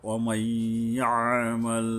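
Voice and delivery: "অমাই আমাল" very slow, the joining y hummed long; level chant of "অমাই আমাল" with a nasal hold between the words; one-alif ghunnah. Shown as a demonstration. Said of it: "এক আলিফ পরিমাণে গুণনা করা". ("অমাই আমাল" গুন্নাহ করে ধীরে টেনে)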